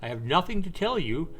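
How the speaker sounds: shouted high and loud, then a snarl; furious shout. Acted. Widower screaming at the nosy journalist, furious. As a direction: blast him out of your face. Man yells at journalist to leave.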